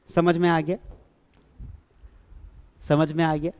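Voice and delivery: ordinary delivery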